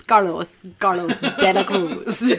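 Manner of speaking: "Carlos De La Cruz" drawn out slowly with relish; hammed-up, slow "Carlos De La Cruz"; showy Spanish pronunciation. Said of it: "when your real estate pronounces Carlos".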